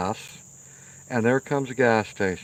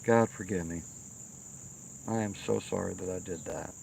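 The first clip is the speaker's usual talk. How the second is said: remorseful prayer; 5 semitones lower, 9 dB quieter than usual. Retold or imitated. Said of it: "I said".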